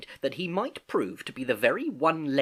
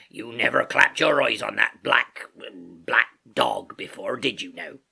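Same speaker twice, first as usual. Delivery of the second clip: slow, pointed sailor's questioning; Silver, very stern; 4 dB louder, slower than usual. A narrator's reading. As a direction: press him sternly, word by word. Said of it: "said Long John very sternly".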